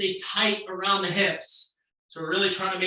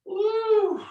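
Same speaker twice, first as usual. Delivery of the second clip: high excited whoop; 13 semitones higher than usual. Real, not acted. Cheering on workout class.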